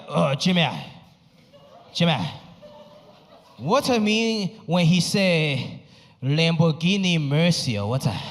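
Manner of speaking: slow, halting questions in a hint of accent; light Chinese dad accent. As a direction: ask like a puzzled immigrant dad. Chinese dad asking about lyrics.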